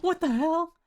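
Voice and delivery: high, loud shocked cry. Real, not acted. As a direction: squeal it in disbelief. Echoing friend in shock.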